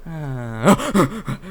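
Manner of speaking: peaceful sigh jumping into a yelp; mimicked startled jolt. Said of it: "look so at peace and go".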